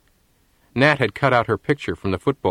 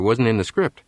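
ordinary delivery

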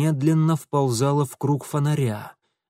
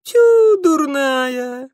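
startled cry, higher and louder; genuinely surprised; 12 semitones higher, 6 dB louder, much slower than usual. A narrator's reading. Thief startled by the girl's scream, surprised. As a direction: caught off guard by her scream. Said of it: "искренне удивился Франт".

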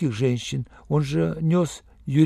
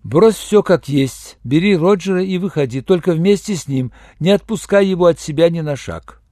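urgent order; 3 semitones higher, 6 dB louder than usual. Acted. Iya orders Yurenev out with Roger, insistently. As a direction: press each instruction home, loudly.

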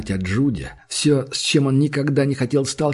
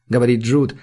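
plain reading voice

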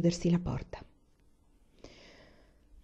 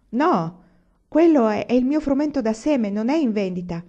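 high, loud burst of protest; alarmed protest; 4 semitones higher, 5 dB louder than usual. Acted. Almanzo protests to Pa about his seed wheat, loud and high. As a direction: stop him taking the seed wheat.

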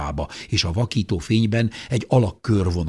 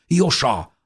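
higher, sharp call of a name; urgent call; 6 semitones higher, 4 dB louder than usual. A narrator's reading. Brother grabs his twin's arm, urgent call. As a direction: call out to get attention fast.

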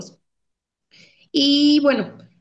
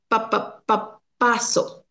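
stuttered syllable repetition. Shown as a demonstration. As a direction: repeat "pa" three times, then land on "paso". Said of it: "Ahí está repitiendo una sola sílaba".

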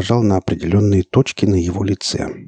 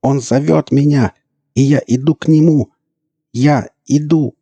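entranced zealot; 8 semitones higher, 5 dB louder than usual. A narrator's reading. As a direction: cry out in fanatical rapture. Entranced elf answers the Monolith.

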